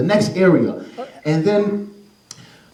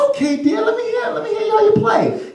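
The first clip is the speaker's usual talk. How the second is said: mom voice. Acted. Mom answers son.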